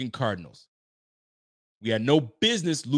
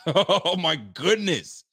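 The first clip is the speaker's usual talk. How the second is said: disbelieving laugh; 3 semitones higher, 4 dB louder, much slower than usual. Real, not acted.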